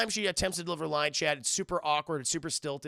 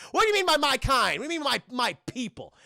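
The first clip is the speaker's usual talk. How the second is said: high, loud, offended challenge; mock indignation; 8 semitones higher, 6 dB louder than usual. Hero challenges old man about his kind, mock-offended. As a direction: fire it back as if insulted.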